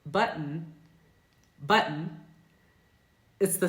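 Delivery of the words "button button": button with the T swallowed as a glottal stop, buh-n. Shown as a demonstration. Teacher modeling button with a glottal T. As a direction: cut the airway for the T in button. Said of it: "So a word like".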